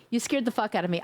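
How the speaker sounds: normal talking voice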